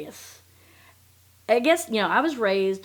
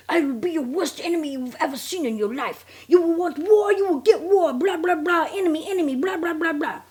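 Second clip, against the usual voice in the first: high, loud mock rant; hammed-up threatening voicemail in a put-on accent; irate threats, imitated; 9 semitones higher, 5 dB louder than usual. Swindler threatening her by voicemail, mock-furious. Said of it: "He's irate, threatens her in voicemails".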